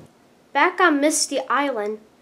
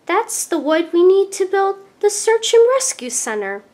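higher, louder eager discovery; excited; 7 semitones higher, 5 dB louder than usual. A narrator's reading. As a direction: bubble over with the good news. Thomas excited about his find.

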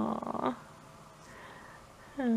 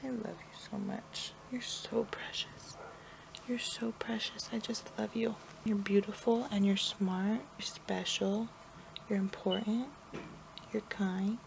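much softer, hushed whisper; 12 dB quieter than usual. Real, not acted. Mother whispering loving words to her napping baby. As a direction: murmur so she stays asleep. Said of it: "whispered affirmations to sleeping baby".

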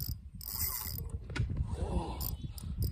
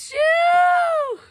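high shout of triumph; excited whoop. Real, not acted. Cheering over the fish.

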